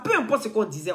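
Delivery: ordinary talk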